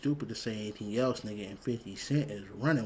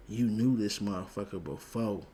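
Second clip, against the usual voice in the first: ordinary delivery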